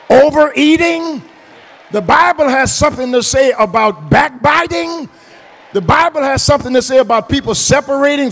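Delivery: loud, high, driving pulpit delivery; impassioned preacher shouting to the congregation; full-force preaching. Real, not acted. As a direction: build the list and hammer each phrase home.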